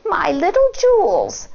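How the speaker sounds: wolf faking a sweet granny voice. Acted. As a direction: coax like a disguised wolf. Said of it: "said the wolf".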